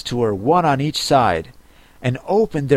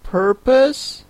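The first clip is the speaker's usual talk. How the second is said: high, slow, tentative query; Alice; 8 semitones higher, slower than usual. Acted. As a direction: venture the correction slowly. Alice hesitantly corrects the Turtle.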